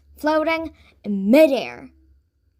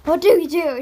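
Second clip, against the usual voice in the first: high, loud frightened shriek; 5 semitones higher, 7 dB louder than usual. A narrator's reading. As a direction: cry it out in panic. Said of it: "shrieked Jane".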